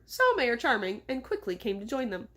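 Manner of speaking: ordinary delivery, nothing marked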